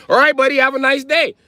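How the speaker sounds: squeaky, cheery small talk; high friendly cop voice. Retold or imitated. Cop inviting himself to dinner, mock high cheery. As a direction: play a chummy cop in a high voice.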